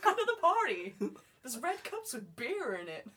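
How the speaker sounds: high, loud American party shout; American teenager. Acted. Chad inviting people to the party, loud American teen. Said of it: "I should sound like an American teenager".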